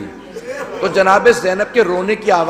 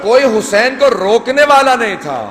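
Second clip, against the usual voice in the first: rising mourning lament; 5 semitones higher than usual. Acted. Preacher mourning Ali Akbar to the gathering, raised lament. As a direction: climb into the lament for the fallen son.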